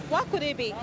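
ordinary delivery

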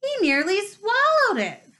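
high, rising outraged howl; howling protest. Acted. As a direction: protest it, voice climbing. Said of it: "Flint was still howling".

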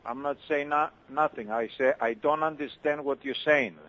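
ordinary delivery

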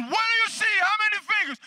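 high, loud, urgent questioning; re-enacted excited shout. Preacher testing the blind man's sight, excited shout. Retold climax of the healing test.

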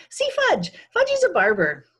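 put-on high, loud toddler voice; proud little kid. A narrator's reading. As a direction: crow it like a small child showing off. Little Fudge proudly shows his haircut.

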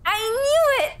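high, excited outburst. Real, not acted. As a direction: shout it out, thrilled. Reacting to reveal in game.

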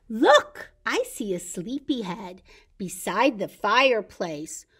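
lively storybook read-aloud, slowing at the ends; bright read-aloud for toddlers; reading to children. Acted. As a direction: read the picture book to the little ones. Reads picture book to children.

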